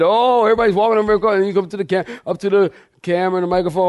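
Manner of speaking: ordinary delivery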